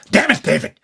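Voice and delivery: lightly voiced gruff Freddy snarl; a hint of the guttural Freddy growl. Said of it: "But I went".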